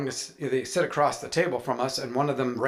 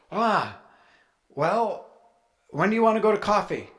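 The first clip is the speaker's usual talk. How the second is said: breezy; 7 semitones higher than usual. Retold or imitated. Mock-breezy reply.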